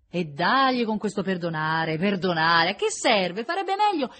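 loud, rising, impatient cut-off; exasperated outburst. A narrator's reading. Prisoner fed up with his begging forgiveness, exasperated. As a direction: snap back in mounting exasperation.

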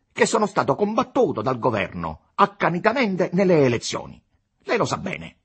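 high, loud argumentative politician; arrogant deputy, indignant. A narrator's reading. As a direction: lecture him in heated self-justification. Capolino argues back at Costa. (deputato arrogante che si giustifica, concitato)

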